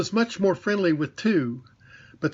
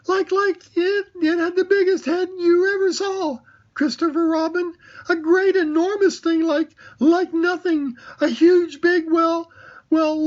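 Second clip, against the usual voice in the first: very high, louder stammering squeak; flustered Piglet; 13 semitones higher, 5 dB louder than usual. Acted. Piglet describes the monster to Christopher Robin, high and flustered. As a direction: babble in panic, searching for words.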